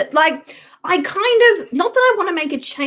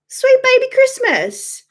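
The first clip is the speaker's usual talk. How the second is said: exasperated exclamation; 12 semitones higher than usual. Real, not acted.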